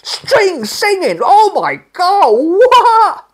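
high, loud, gasping awe; high, loud awestruck cries half laughing; overwhelmed exclamation. Retold or imitated. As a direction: burst out in high overwhelmed wonder. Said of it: "and it's just".

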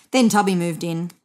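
ordinary delivery, nothing marked

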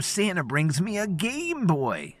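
put-on high, slow kid voice full of hope; childlike voice. A narrator's reading. Grown man playing a child, high and slow. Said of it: "spoke in childlike voices".

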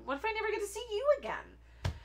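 very high, pleading pretend-sad voice; playful tragic whine; mock sadness. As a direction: ham up the heartbreak in a high little voice. Mock-sad plea to viewers to subscribe.

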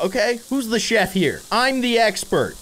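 high, affronted chef; huffy, stretching the last words; mock indignant. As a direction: pull rank in playful offense. Chef challenged by cat's hint.

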